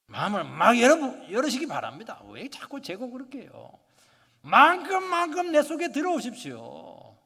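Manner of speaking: loud, high, ringing sermon delivery; preaching at full force. Acted. Pastor urging the congregation to open their hearts, preaching loudly. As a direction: proclaim it to the whole hall.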